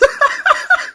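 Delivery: high rising laugh. Real, not acted. Laughs at own rant.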